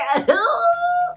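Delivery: sudden high burst of laughter. Real, not acted. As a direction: cackle out loud. Laughing at own joke.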